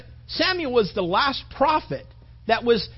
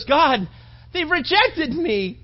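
high, drawn-out mock lament; acted weeping plea; 5 semitones higher, 3 dB louder, slower than usual. Samuel complains to God of being rejected, acted weeping. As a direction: cry out in hurt, higher and slower at the end. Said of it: "he's weeping before the Lord".

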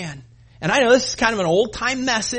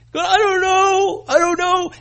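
much higher, louder, strained cry; a desperate tearful wail, slowed; imitating sobbing student; 12 semitones higher, 8 dB louder than usual. Preacher imitating crying student Drew, high anguished wail. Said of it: "He was crying".

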